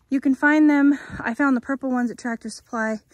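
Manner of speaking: ordinary delivery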